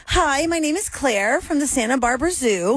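high, slow, measured introduction; zoo guest character. Acted. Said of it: "She'd say".

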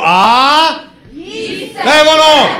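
high, loud triumphant shout; excited cheer. Acted. Comedian praising the audience's singing, loud excited cry. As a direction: celebrate the crowd finally getting it.

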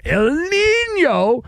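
high, slow stretched out El Nino; jokey sing-song. Pretend. Host tossing a weather joke, high drawn-out sing-song.

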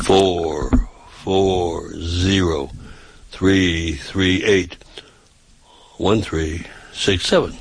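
phone number drawn out, very slow; slow and deliberate. Shown as a demonstration. Repeating the phone number for listeners who find it too fast, slowly. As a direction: stretch each digit, pausing between groups. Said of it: "I'd say it slow".

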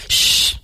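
long sibilant shush; hushing shh. Shown as a demonstration. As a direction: demonstrate a drawn-out "shh". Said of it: "los sonidos del mandato del silencio".

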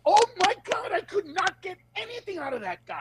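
high, whiny mock defeat; a comic complaining he got nothing from the crowd; hammed-up frustration, pitched high; exasperated comedian. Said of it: "he'll get up and go".

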